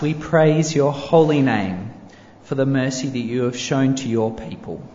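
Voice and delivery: ordinary delivery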